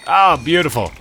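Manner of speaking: usual speaking voice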